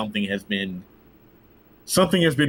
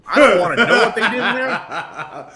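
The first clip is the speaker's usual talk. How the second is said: high, loud laughing disbelief; laughing, higher and louder; 10 semitones higher, 7 dB louder than usual. Real, not acted. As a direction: laugh it out, half horrified. Laughing about Bill Cosby room.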